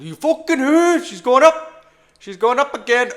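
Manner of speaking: high, loud and thrilled; excited shout. Real, not acted. Trader watching price rise, excited shout. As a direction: cheer the price up.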